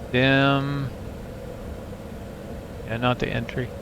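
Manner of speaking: usual speaking voice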